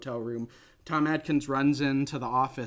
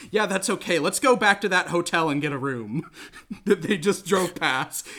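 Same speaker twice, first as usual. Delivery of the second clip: loud, cocky hero voice, a bit higher; brash can-do leading man; confident Tom Atkins; 3 semitones higher, 5 dB louder than usual. Retold or imitated. Host retelling the movie hero, loud and cocky.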